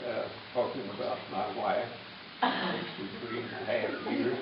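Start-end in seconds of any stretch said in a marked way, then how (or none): none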